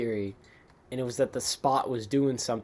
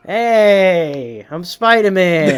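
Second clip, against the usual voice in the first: put-on loud, slow Brooklyn accent Spider-Man; hammy Brooklyn accent impression; 5 semitones higher, 15 dB louder, much slower than usual. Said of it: "He's suddenly have a Brooklyn accent".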